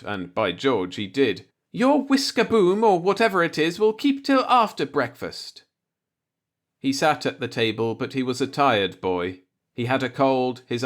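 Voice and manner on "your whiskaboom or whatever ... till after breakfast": put-on much higher, bossy old landlady; landlady scolding. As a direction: scold him like a stern landlady. Landlady still scolding the boarder.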